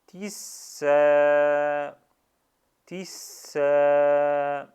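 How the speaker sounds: tisa loud, s doubled, a held long. Shown as a demonstration. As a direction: lean on the s, then hold the a in tisa. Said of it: "shadda et le prolongement". (tisa avec s doublé et a prolongé)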